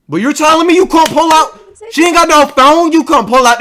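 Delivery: very high, loud disbelief; incredulous protest. Real, not acted. Viewer challenging mom's excuse for not calling, incredulous protest.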